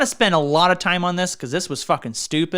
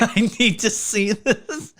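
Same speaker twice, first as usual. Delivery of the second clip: drawn out through laughter; slow, higher, laughing words; 5 semitones higher, slower than usual. Real, not acted. Host reacting to the pudding story, laughing.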